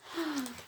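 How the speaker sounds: quick frightened intake of breath; sharp gasp. Acted. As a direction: gasp as they tip over the edge. Squirrels going over the edge.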